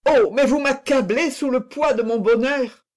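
high, fervent exclamation; overwhelmed with joy. A narrator's reading. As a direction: protest as if crushed by happiness. Fouquet overwhelmed by declared love.

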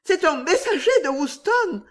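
high alarmed exclamation; exclaiming. A narrator's reading. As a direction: cry it out in sudden fear. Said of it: "s'écria-t-elle".

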